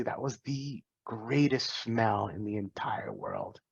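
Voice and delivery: slow, hushed whisper of wonder; a slow hush savouring the memory. Real, not acted. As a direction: breathe it out like a secret treasure. Dramatic memory of loving the smell.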